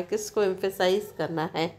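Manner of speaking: ordinary talk, nothing marked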